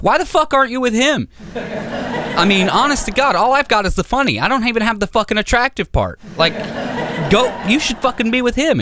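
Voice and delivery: high, fast, laughing rant; jealous boyfriend ranting at his girlfriend, high and laughing; mock outrage. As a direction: rant in hammed-up disbelief.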